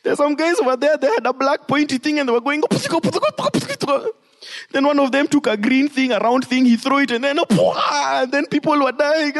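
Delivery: high, frantic crying soldier; preacher mocks a crying soldier reporting to his general; panicked soldier. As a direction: whine like a scared soldier begging the general. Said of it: "they're like".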